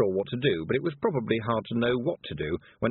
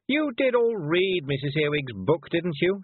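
high, slow, pointed demand; imperious schoolmistress-like questioning; 10 semitones higher, 4 dB louder, slower than usual. A narrator's reading. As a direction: press them for the answer, higher voice. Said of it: "she demanded".

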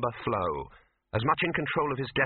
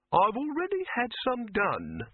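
high, coolly superior character voice; cold; 11 semitones higher than usual. A narrator's reading. Silverfish answering Dibbler about posters, coldly. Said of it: "said Silverfish coldly".

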